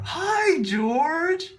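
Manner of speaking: high sing-song greeting, mocking himself; mock cheery greeting. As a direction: chirp it up high and sweet.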